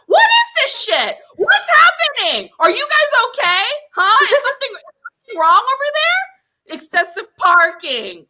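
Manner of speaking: high, shouted, incredulous rant; exasperated outburst. Retold or imitated. Caller at her wits' end with the animal shelter, exasperated shouting. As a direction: build to a high-pitched shout of disbelief, then ease down.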